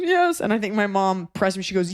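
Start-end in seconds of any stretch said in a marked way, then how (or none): 0.0-0.4 put-on high, whiny little-kid reply; imitating crying child. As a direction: squeak it out like a tearful small boy. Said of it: "he's crying".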